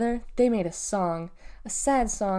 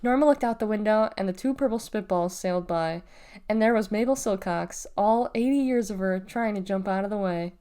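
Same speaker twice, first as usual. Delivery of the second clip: plain reading voice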